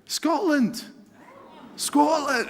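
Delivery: put-on high, exclaimed Scotland in a failed Scottish accent; a bungled go at a Scottish Scotland; attempted Scottish accent. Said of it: "I can't do the accent right".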